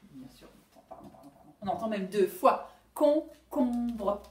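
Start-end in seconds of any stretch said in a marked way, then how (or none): 0.1-1.5 whispered self-correction; whispered aside. Real, not acted. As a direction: whisper the apology to yourself. Corrects her own mistake.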